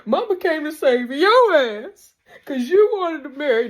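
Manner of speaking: hammered-out mock accusation; Kane blaming Drew. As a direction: Kane yelling at Drew that it's his fault. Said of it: "when Kane got telling him".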